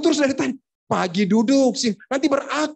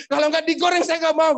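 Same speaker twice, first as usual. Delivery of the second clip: mock tantrum; 9 semitones higher than usual. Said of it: "ngamuk-ngamuk".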